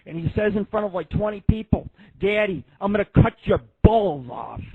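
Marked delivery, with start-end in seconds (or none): 2.2-4.6 a mock mobster threat, louder; mafia kid. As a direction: do the little son playing gangster. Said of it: "he starts talking like he's the mafia".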